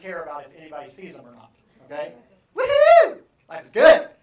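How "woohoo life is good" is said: very high, loud cheer; excited whoop. Acted. Teacher acting out high I joy, very high and loud cheering. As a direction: burst out with pure joy.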